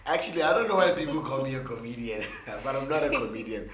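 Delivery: normal talking voice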